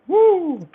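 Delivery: high-pitched "woo"; excited whoop. Real, not acted. Excited about Muay Thai fights.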